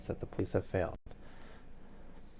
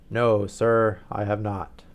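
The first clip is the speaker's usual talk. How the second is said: a louder, booming nobleman; booming Duke; 9 dB louder, slower than usual. Acted. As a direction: let the Duke's words boom out like a dinner gong. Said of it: "I was already using my deep voice".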